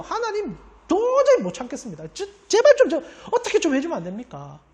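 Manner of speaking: high, half-laughing mock complaint to God; exasperated prayer. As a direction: plead in fed-up frustration, chuckling through it. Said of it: "아예 대놓고 원수 죽여달라고 합니다". (웃음 섞인 높은 목소리로 답답함을 토로하는 기도)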